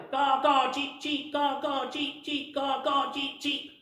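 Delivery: high cawing and cheeping like birds; bright birdsong calls in turn. Acted. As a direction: squawk the caws, chirp the cheeps. Birds flying off calling.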